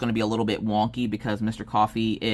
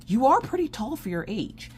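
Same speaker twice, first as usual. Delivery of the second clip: imitating teacher; 10 semitones higher than usual. Teacher remarks on the daughter.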